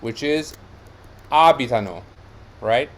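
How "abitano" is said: abitano as AH-bi-ta-no, falling away; stress on first syllable. Shown as a demonstration. Teacher showing they form matches first person, first-syllable stress. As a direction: punch the A of abitano. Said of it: "it takes the same stress as the first person".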